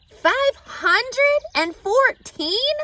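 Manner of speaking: high, loud, slow disbelief; astonished. A narrator's reading. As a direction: stretch it out, amazed. Wilbur astonished.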